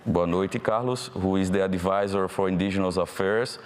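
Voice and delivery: ordinary talk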